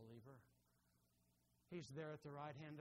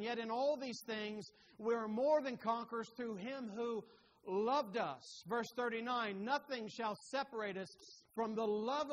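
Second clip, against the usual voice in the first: higher, louder, driving pulpit voice; full-force preaching; 4 semitones higher, 8 dB louder than usual. Acted. Preacher proclaiming God's enduring love to the congregation, impassioned. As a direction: preach it with building fervour.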